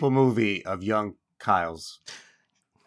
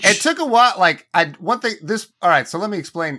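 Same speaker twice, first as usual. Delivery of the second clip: excited; 7 semitones higher, 5 dB louder than usual. Real, not acted. Excited burst of storytelling.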